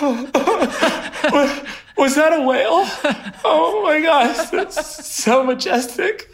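high, teary squeal broken by laughter; half crying, half laughing. Retold or imitated. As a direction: gush at the whale, laughing through mock tears. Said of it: "I'm, like, half crying".

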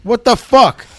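loud, high, slow Yonkers demand; Yonkers accent. Acted. Man showing how he gets things done at home, Yonkers accent. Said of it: "when I come with my Yonkers".

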